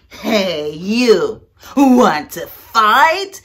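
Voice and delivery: loud, slow drawn-out dare; the grouchy ladybug dares the yellow jacket; challenging shout. Acted. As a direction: bark the challenge at a bigger bug.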